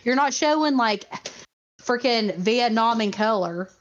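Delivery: normal talking voice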